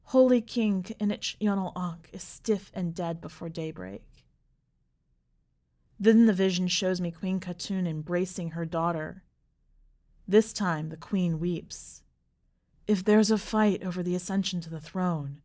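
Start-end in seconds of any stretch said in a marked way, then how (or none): none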